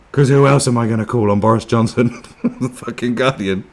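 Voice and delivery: high, loud mockery breaking into laughter; mocking, laughing. Acting the neighbour phoning The Guardian, laughing mockery. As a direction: crack up at the absurd phone call.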